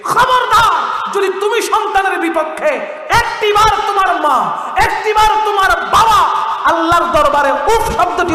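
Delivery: high, loud fiery sermon; fiery preacher shouting the verse; full-force preaching. Acted. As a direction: thunder the warning at the crowd.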